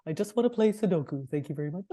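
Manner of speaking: lightly voiced grumpy old dog; a hint of a tired old dog. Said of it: "my twenty-year-old dog goes like this".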